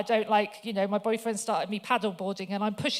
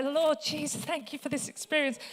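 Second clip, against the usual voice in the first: gushing thanks; 5 semitones higher than usual. Retold or imitated. Gushing thanks to kneeling colleagues.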